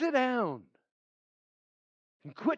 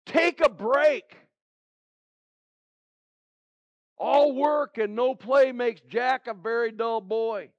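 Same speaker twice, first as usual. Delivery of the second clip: fatherly shout. Retold or imitated. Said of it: "a father this morning that's saying".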